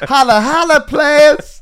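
very high, loud crowing call; hammed-up hype man, laughing; mock hype greeting. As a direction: whoop it out as a joke. Hamming up a silly class greeting.

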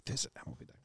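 whispered through laughter; breathy laughing whisper. Shown as a demonstration. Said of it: "That's so silly".

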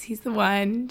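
usual speaking voice

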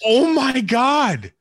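very high, loud, slow exclamation; over-the-top shocked holler; astonished shout. Real, not acted. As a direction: draw it out in loud disbelief. Reacts to huge screen time.

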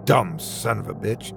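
low angry growl. Acted. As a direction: grumble it out under your breath. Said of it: "Wayland growled".

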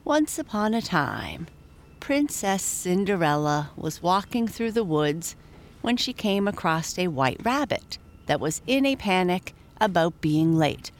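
usual speaking voice

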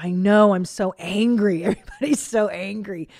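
mock angry friend. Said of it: "She's like".

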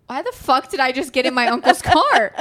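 loud, mock-baffled question; lively, exaggerated confusion; perplexed cousin. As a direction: imitate a bewildered kid, amused. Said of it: "Eric being so perplexed".

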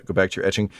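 ordinary delivery

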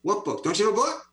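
the keen audience pressing him for a book; eager demand. Retold or imitated. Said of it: "people began saying".

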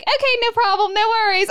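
high, airy and carefree; breezy unbothered. Retold or imitated. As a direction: brush off a fatal mistake cheerfully. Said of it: "And she's like".

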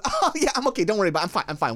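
high, breezy mock reassurance; lightly put-on chirpy voice; smiling, giggly girlfriend. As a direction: imitate someone brushing it off too cheerfully. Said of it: "she starts smiling and laughing".

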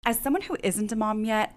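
ordinary delivery

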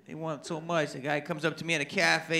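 normal talking voice

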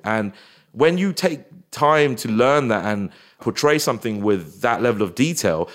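ordinary talk

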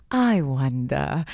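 low, slow, mysterious pondering; imitating a wand seller's slow musing; famous wand-keeper line. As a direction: draw it out low and theatrical. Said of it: "he does the famous line".

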